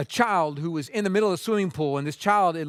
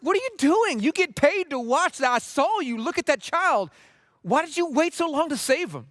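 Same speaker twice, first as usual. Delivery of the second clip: high-pitched, indignant acted rant; retold livid outburst; 9 semitones higher than usual. Angry man confronts lifeguard who waited to save child, retold livid. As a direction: scold as the outraged bystander, voice pitched way up. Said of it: "that guy was livid".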